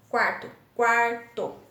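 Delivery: quarto, then QUAR - TO, slow and separated; teacher sounding out QUAR-TO; word, then syllables. Shown as a demonstration. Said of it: "dois pedacinhos".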